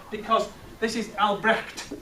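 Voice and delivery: Albrecht said slowly, its hard ch grated out; harsh German consonants. Shown as a demonstration. Teacher to class, showing the German sound of the name, harsh slow consonants. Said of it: "We've got harsh consonant sounds in there".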